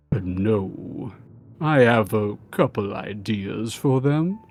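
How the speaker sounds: slow, low, scheming lord voice. Acted. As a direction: muse on their fate slowly. Lord answers the guard.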